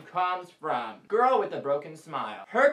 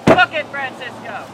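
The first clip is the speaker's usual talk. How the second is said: loud command; 5 semitones higher, 9 dB louder than usual. Acted. Catchphrase order to run.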